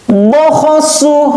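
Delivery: high, crisp Quran letters; fast, firm recitation. Shown as a demonstration. Teacher shows pupils fast, firm letter reading, high and crisp. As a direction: snap out each syllable firmly. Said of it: "Harus gitu".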